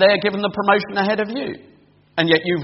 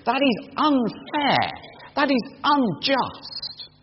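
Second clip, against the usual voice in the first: slow, higher, each word weighed; slow outraged emphasis; 8 semitones higher, much slower than usual. Acted. Preacher spelling out the unfairness to the congregation, slow and outraged. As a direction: let the injustice land slowly.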